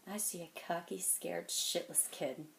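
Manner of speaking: soft, measured, word by word; quiet put-down. Acted. Man sizing up a cocky kid, quietly cutting.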